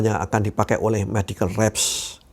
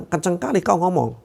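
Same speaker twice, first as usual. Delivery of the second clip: raised, complaining retort; annoyed listener; 6 semitones higher than usual. Retold or imitated. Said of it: "Orang bilang".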